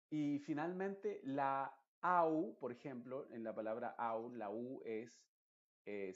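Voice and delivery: normal talking voice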